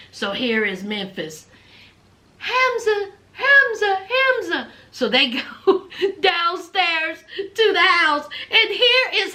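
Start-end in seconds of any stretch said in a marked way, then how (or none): 2.4-4.7 high, sing-song call of Hamza; imitating a woman calling Hamza. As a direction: call him from across the house.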